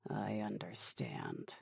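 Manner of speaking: very low, slow and soft; grudging submission. Acted. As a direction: submit darkly, almost under the breath. Subordinate darkly submits to threat.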